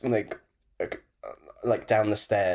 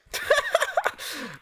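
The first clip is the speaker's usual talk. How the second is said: squealing burst of laughter; high-pitched laugh. Real, not acted. Loud laugh at joke.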